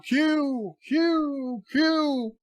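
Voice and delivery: high called-out yell, repeated. Retold or imitated. Picard yelling out for Q in mock shout. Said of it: "He just yells out".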